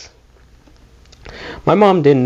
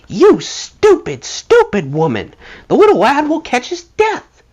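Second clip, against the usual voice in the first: much higher, slow outraged tirade; furious scolding. Retold or imitated. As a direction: tear into her in disbelief.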